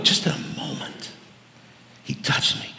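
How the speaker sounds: a breathless whispered recollection; whispered awe. Real, not acted. Recalls Jesus touching him.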